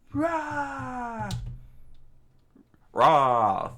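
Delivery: a sobbing, high, loud howl of rage; crying scream. Acted. Bearded man screaming through tears at his enemies. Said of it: "he screamed out with tears in his eyes".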